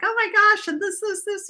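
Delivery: retelling her excited rush to a friend; gushing excitement. Retold or imitated. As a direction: babble like a kid who just found out. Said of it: "I'm like".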